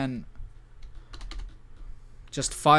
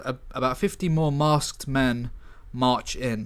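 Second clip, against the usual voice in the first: ordinary talk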